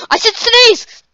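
high, loud mock order; mock demand. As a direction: bark it like a fed-up boss. Commanding the video to sneeze.

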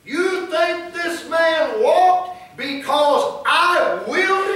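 loud, high, drawn-out challenge; Peter. Acted. Apostle denies his own power healed the man, forceful challenge. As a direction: hammer each question at the crowd from the pulpit. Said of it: "That's what Peter's saying".

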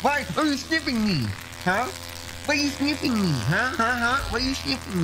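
high, soft pet-talk voice. Real, not acted. Dad asking the sniffing dog in a high, soft pet voice.

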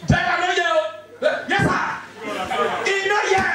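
high, light voice of the driver; a hint of a meek driver answering. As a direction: play the driver answering his boss. Voicing the driver.

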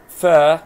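فا held as a loud long "faa"; long vowel. Shown as a demonstration. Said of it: "هنا مد بالألف".

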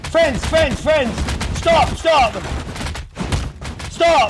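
loud, high repeated cries; frantic pleading. Pretend. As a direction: holler for mercy, each word falling. Pleading not to be attacked.

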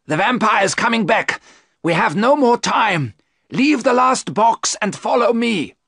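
high, loud, urgent commands; urgent shout. A narrator's reading. Van Helsing ordering the men to flee, urgent shout. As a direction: hurry them out, shouting.

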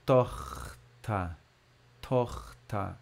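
"Tochter" with the ch scraped hard in the throat; scratchy German ch. Shown as a demonstration. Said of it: "Aí a garganta tem que arranhar".

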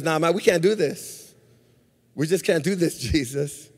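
low, hushed aside as Peter; quiet private objection. Acted. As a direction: object softly, as if in confidence.

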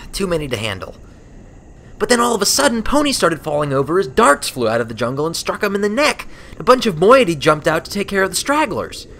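put-on brash young flier, rising with the action; Rainbow Dash voice. A narrator's reading.

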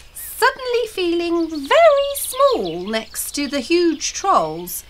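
ordinary delivery

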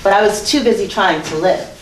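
spoken-word poem, emphatic and rhythmic; recital de poesía. Acted. Recites her poem on stage.

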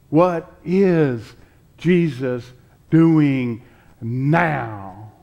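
usual speaking voice

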